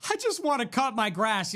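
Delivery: high, put-on plaintive voice; mocking woe-is-me lament; mock whiny songwriter.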